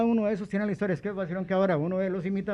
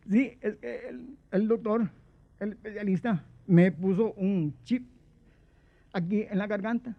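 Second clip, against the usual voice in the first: squeaky Guillén voice; 4 semitones higher than usual. Pretend. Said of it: "cuando yo estoy hablando con esta voz". (voz aguda y lenta imitando a Guillén)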